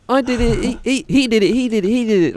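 high, excited comic pointing of blame; Bernie Mac style. Pretend. Playing Bernie Mac, pointing at the kids, high and excited. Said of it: "I'm Bernie Mac up in here".

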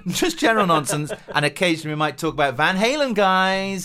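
loud excited host voice; hyped YouTuber host. Pretend. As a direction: pump it up like a YouTuber.

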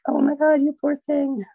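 high, loud gushing fake pity; mock sympathy. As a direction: gush over her like a nosy Facebook commenter. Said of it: "people to be like".

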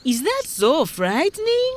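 high, rising sceptical question; doubting fisherman, pitched high; unimpressed fisherman. Acted. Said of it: "the man with a fish trap asked".

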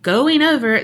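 ordinary talk

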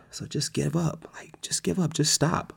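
soft, half-whispered coaxing; insinuating devil. Acted. Devil telling believer to give up, softly coaxing. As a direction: tempt them quietly to quit. Devil urging them to quit.

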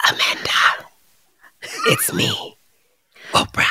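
creepy whispered rat voice, soft and eerie; ominous whisper. Pretend. Rat ghost scratching at the bedroom door, whispering ominously. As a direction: breathe it through the bedroom door. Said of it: "ominously whispering".

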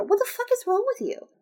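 very high, incredulous outburst; exasperated. Real, not acted. Reader breaking off to scold the queen, exasperated. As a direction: squeal it in disbelief at the character.

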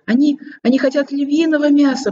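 high squeaky toy voice; rubbery high squeaky toy. Acted. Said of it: "пропищала зайчиха". (писклявым голосом)

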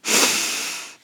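two sharp sniffs in through the nose; audible double nose inhale. Shown as a demonstration. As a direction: sniff in twice, short then long. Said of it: "So double inhale".

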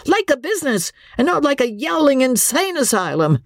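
high, exasperated tirade; exasperated outburst. A narrator's reading. Husband snapping angrily at his frantic wife. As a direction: lose patience and rail at her. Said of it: "Andy's patience snapped".

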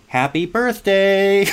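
slow, high sing-song reading; sing-song card. Retold or imitated. Boy reading the teacher's card aloud, lightly sing-song. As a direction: read the card like a chirpy greeting. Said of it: "and it says".

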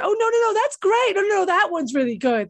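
high, eager gushing; a lightly voiced gushing Irish friend; gushing Irish fan. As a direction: rave about it like a proud Irish fan. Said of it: "everyone I know is Irish ... you say".

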